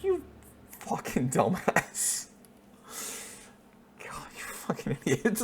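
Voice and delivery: hissed loving insult, under the breath; mock whisper. Owner whispering jokingly at the silly dog.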